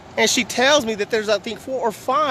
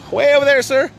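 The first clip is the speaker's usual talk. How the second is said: raised, higher voice directing someone; calling out; 4 semitones higher, 5 dB louder than usual. Real, not acted. Man directing a lost driver to the lines, calling out. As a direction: call it out across a parking lot.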